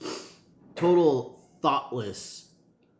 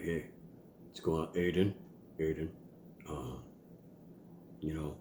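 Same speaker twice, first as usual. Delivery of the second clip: lower, softer put-on deep voice; hammed-up deep voice of authority; deep dad voice; 5 semitones lower, 6 dB quieter than usual. Shown as a demonstration. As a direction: drop low like a stern father. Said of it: "train my voice to, uh, talk like this".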